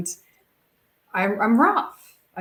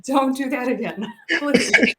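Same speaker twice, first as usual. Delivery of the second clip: pleading through a laugh, higher; laughing plea; 7 semitones higher, 4 dB louder than usual. Retold or imitated. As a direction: beg him while still laughing. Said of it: "I'm like".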